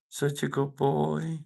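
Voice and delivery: high, slow doting pet voice. Real, not acted. Owner praises his dog, high slow baby talk. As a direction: draw it out sweetly for the dog.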